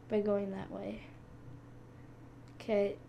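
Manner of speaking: normal talking voice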